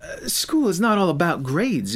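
coldly lecturing the student; cold teacher. Acted. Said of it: "She coldly continued".

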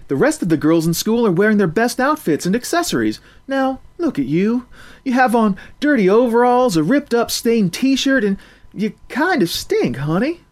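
teasing cafeteria worker; lightly voiced lunch lady. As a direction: scold her kindly. Narrator voicing lunch lady.

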